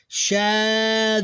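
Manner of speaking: "Shaddu" drawn out on a high, level note; blended without stopping. Shown as a demonstration. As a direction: stretch "Shaddu" long, sliding through the doubled consonant unbroken. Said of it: "I didn't stop between the two syllables".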